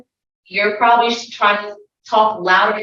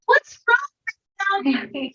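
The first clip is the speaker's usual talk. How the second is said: put-on child asking; 11 semitones higher than usual. Child asks adult after sigh.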